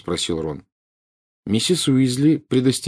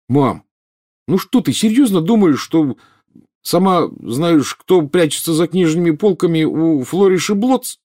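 put-on high-pitched teasing boy; giggling mockery; 8 semitones higher, 4 dB louder than usual. A narrator's reading. Boy teases mother over bookshop dangers, mocking. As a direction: poke fun at mum's worries. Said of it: "захихикал Рон".

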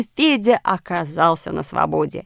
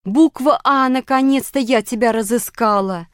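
raised, eager voice; loud and pitched up, easing slightly; excited shout; 5 semitones higher, 5 dB louder than usual. A narrator's reading. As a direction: cry out on finally finding someone. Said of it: "кричала она".

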